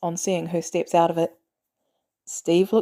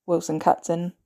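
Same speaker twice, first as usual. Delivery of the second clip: ordinary delivery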